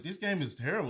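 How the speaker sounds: high, annoyed grumble; exasperated complaint. Retold or imitated. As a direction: complain about the game. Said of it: "every time I was like".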